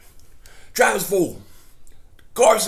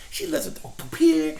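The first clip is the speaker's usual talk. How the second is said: pitched higher in outrage; indignant, high; 9 semitones higher than usual. Real, not acted. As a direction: say it up high, incredulous. Indignant first take.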